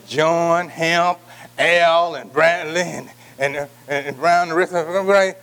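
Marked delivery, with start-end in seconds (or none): none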